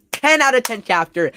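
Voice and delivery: high, loud, excited praise; thrilled verdict. Real, not acted. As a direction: rave about what was just read. Raving verdict on the chapter.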